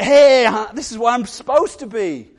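loud, high, joyful hey; a hippo finally in the water, thrilled; delighted hippo. Pretend.

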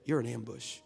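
ordinary talk, nothing marked

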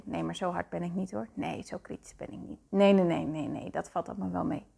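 quiet, fast brush-off, half under the breath; mock-dismissive denial, hurried and hushed. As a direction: wave it away with a hushed mumble. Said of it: "vaak zeg je".

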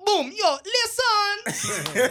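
big up the crowd like a hyped artist; hyped artist shoutout. Retold or imitated. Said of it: "how artists carry on when they reach".

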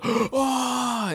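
high amazed oh; an impressed audience's oh; lightly voiced amazed crowd; mock amazed oh. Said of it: "half of the theater was like".

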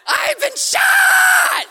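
drawn-out high scream of shock; reenacted shocked screaming. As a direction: scream it out long and piercing. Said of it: "as I'm reenacting this".

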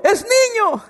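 high, excited yell of the news; joyful shout. Retold or imitated. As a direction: shout it out like a thrilled new father. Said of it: "pues grité porque era niño".

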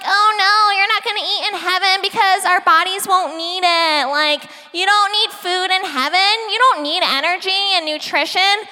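a silly put-on voice mocking the doubters; mocking naysayer voice. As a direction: play the know-it-all skeptic, lightly hammed. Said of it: "I don't know where that voice came from".

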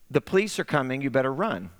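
ordinary talk, nothing marked

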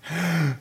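sharp, soft gasp for air; snatch a quick frightened breath; a short panicked intake of breath. Shown as a demonstration. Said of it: "It usually goes something like this".

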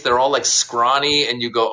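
ordinary talk

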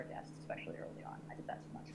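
soft whispered admission; whispering. Real, not acted. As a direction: drop to a whisper. Quiet confession of regret.

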